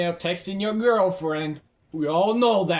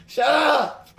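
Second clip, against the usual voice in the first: loud, high, stretched shout; heated yell; 9 semitones higher, 5 dB louder than usual. Real, not acted. Player silencing someone, heated yell. Snapping mid game.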